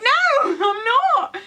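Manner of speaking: high, slow, drawn-out protest with a laugh; laughing denial. Retold or imitated. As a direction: stretch it up high and giggle through it. Denying to her mum.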